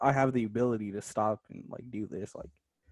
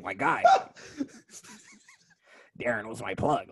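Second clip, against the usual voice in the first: crackhead voice. Acted. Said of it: "a random crackhead".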